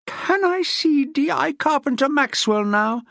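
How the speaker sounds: woman under a policewoman, strained; pinned solicitor. A narrator's reading. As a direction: squeeze it out while pinned down.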